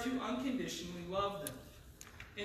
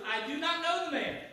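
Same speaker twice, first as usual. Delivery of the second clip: higher, emphatic denial; sworn denial; 6 semitones higher than usual. Acted. Peter denying Jesus with an oath, emphatic. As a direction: deny it firmly, as if under oath. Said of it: "denied it with an oath".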